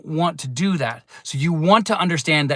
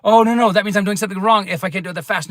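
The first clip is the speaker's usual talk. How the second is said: quick, fretful imitation of a worried student; a teacher imitating an anxious learner's quick panic; mock panic; 3 semitones higher, faster than usual. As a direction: rattle it off like someone freaking out. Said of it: "I don't want you freaking out being like".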